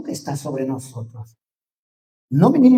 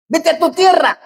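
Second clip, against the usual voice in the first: high, loud taunting demon voice; mocking demon; 12 semitones higher, 6 dB louder than usual. Demon tells Jesus to leave.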